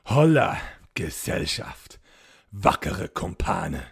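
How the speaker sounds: a hollow call from the dark, slow and looming; deep hollow voice. A narrator's reading. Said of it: "rief eine tiefe, hohle Stimme".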